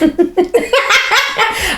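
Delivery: a burst of high laughter at her own joke; real amused giggle. Real, not acted.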